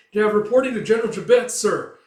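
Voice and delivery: high, loud and fast; breathless messenger; urgent report. Acted. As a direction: burst in with the news.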